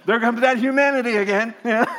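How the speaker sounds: said through loud laughter; laughing. Real, not acted.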